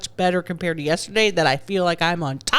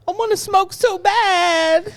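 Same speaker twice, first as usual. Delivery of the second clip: whiny craving; 10 semitones higher, slower than usual. Real, not acted. Craving a cigarette, whining.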